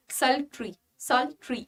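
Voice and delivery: sultry ending in a soft ri; pronunciation demo. Shown as a demonstration. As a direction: repeat sultry calmly, ri at the end. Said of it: "आपको क्या बोलना है?".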